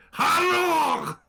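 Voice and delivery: loud, high Scottish hello; big mock Scottish hello; Scottish shout. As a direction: bellow a hammed-up Scottish greeting. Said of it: "They were Scottish".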